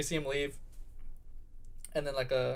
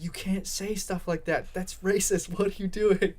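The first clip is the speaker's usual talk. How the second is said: higher-pitched mock indignation; indignant scolding; 5 semitones higher than usual. Guy telling his girlfriend she can't say that, indignant. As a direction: scold in a raised, drawn-out pitch.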